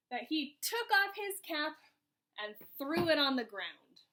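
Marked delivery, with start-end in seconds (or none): none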